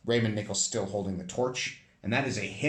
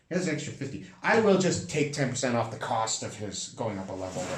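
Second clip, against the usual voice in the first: normal talking voice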